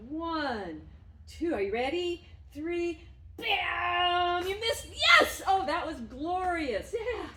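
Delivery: rising to a high, loud, delighted whoop; over-the-top happy cheering for the dog; effusive. Real, not acted. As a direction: make a huge deal of it. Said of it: "And I'm very effusive".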